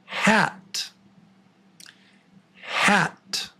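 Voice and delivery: "hat" whispered high with a flat front "a"; breathy test word "hat"; quiz word demo. Shown as a demonstration. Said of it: "The word is".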